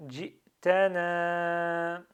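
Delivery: "Jitana" very slow and loud, final a held long; prolonged ending. Shown as a demonstration. Teacher shows the ending, "Jitana" with long last vowel. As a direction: draw out the end of "Jitana". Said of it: "Je prononce à la fin".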